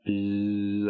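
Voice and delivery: drawn-out buzz noise; imitated buzzing. Teller showing how the head felt, imitated buzz. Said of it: "like, buzzing".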